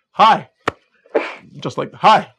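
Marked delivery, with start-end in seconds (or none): 0.1-0.5 high, loud awkward hi; weird loud hi. Retold or imitated. Coach retelling his awkward hi to a girl, loud and high. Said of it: "the weirdest hi ever". 1.9-2.3 high, loud awkward hi; weird loud hi. Retold or imitated. Coach retelling his awkward hi to a girl, loud and high. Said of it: "the weirdest hi ever".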